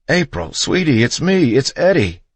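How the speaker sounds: high, loud, desperate coaxing; husband calling to his frightened wife; loud pleading. A narrator's reading. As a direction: beg her to recognize you.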